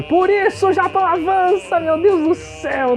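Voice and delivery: excited. Acted. Mock excitement about Japan.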